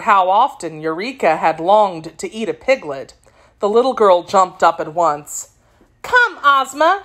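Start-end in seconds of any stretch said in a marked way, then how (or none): 6.0-7.0 high, slow anxious urging. A narrator's reading. Little girl to the princess about the lost piglet, anxious. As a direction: urge her along with mild worry. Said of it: "she said anxiously".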